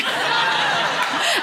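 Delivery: loud, high burst of laughter; real laugh. Real, not acted. Storyteller tickled by guest's reply, real laugh.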